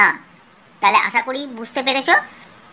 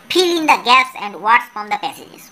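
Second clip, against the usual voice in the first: slow reading aloud; much slower than usual. Shown as a demonstration. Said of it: "teacher reads instruction slowly".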